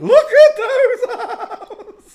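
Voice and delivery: very high, loud, slow laughing shout; shrieking laugh. Real, not acted. Laughing at the Princess Stephanie photo, loud shriek. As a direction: howl it out laughing.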